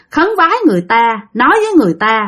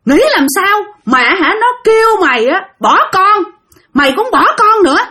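higher, raised accusing rant; possessed scolding; 6 semitones higher than usual. Retold or imitated. Possessed Hưng berating Tiên, an angry higher scolding. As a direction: jab a finger and scold her hard. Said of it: "Chửi vậy nha".

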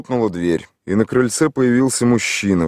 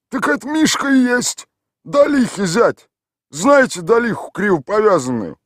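high, put-on village voice; gruff local man answering a stranger; rough villager; 14 semitones higher, 4 dB louder than usual. A narrator's reading. Tolik answers the city stranger in a rough village voice.

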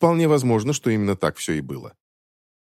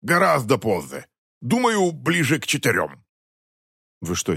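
gruff gambler; 3 semitones higher than usual. Acted. Eric the gambler voiced.

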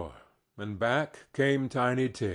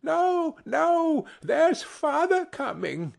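put-on very high, drawn-out child voices; high-pitched child's alarm; children crying out; slower than usual. A narrator's reading. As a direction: warn everyone like excited little children. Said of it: "cried the two young Cratchits".

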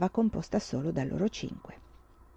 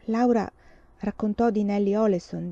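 ordinary delivery

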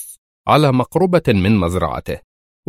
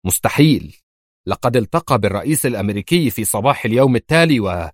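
plain reading voice

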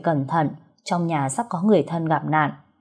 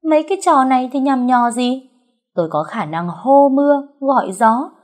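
louder, higher braggart's voice; cocky boasting; 7 semitones higher, 5 dB louder than usual. Acted. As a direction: brag and brush it off grandly. Fortune-teller brushing off praise with boasts. (khoác lác, vênh váo, lên giọng)

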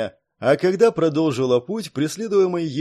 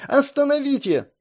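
repeated shout; 12 semitones higher than usual. A narrator's reading. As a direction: call out again, desperate. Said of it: "кричала".

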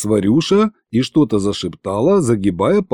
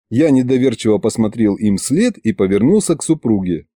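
ordinary delivery

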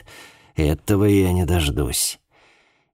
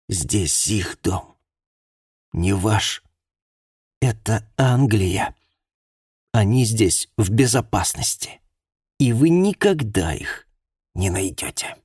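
bitter; 4 semitones higher than usual. Acted. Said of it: "последний горький взгляд".

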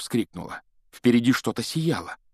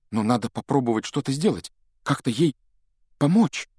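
faltering; 5 semitones higher, 3 dB louder than usual. Acted. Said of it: "сбивчиво начал Гарри".

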